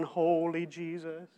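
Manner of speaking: drawn-out, mock-solemn intoning; slow comic holy proclamation; Monty Python. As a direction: stretch it out slowly in mock reverence. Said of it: "There's the Monty Python".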